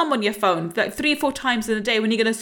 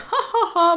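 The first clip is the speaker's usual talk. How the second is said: higher-pitched hearty "ha ha ha"; hearty laugh; 5 semitones higher than usual. Shown as a demonstration. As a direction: demonstrate your big belly laugh. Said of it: "I have a hearty laugh".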